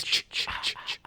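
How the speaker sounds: breathy unvoiced ch ch ch ch; whispered ch ch ch. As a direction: whisper the ch ch ch sound. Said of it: "Except it doesn't".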